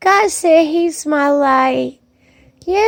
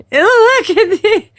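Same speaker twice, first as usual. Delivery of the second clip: laughing; 8 semitones higher than usual. Real, not acted. Laughing while showing it.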